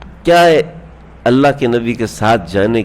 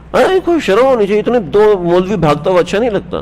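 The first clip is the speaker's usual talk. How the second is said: mock scandalised complaint; 8 semitones higher than usual. Mocking complainers about bearded footballers.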